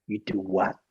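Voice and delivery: low, quiet American film-star impression; Clint Eastwood. Film star puzzled by a bodyguard's answer, low impression. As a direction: question him softly in a deep movie-legend voice. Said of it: "and in, in that accent".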